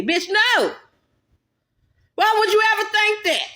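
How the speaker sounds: high, loud, disgusted refusal; outraged no. Acted. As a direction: slap the idea away. Shocked angry rejection.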